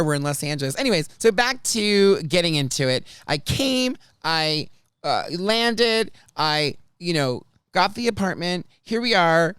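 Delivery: normal talking voice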